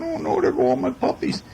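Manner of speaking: lightly voiced mama dog missing her puppies; mother dog lament. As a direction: moan it like a mama losing her pups. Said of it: "the mommy doggy's going".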